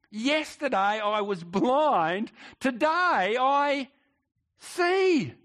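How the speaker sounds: a healed man proclaiming his sight; rising triumphant testimony. Acted. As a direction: build from a slow start to a loud high peak. Preacher voicing healed blind man.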